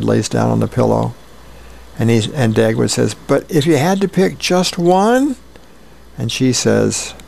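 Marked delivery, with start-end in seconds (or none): 3.1-5.4 high rising hopeful question; pleading question. Acted. Husband pressing his wife to pick just one, pleading. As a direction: push once more, pitch climbing to the end.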